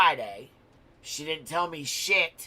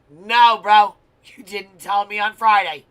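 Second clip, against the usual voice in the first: loud, high angry retort, raised voice; yelling at daughter; 3 semitones higher, 12 dB louder than usual. Retold or imitated. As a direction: snap back hard at the kid, loud and high. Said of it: "I'm like".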